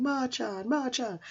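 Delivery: calling a lost friend, high and soft; dreamy calling out. Retold or imitated. Dreaming boy calling for his lost friend, high and soft. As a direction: call softly into the empty field. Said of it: "He's going".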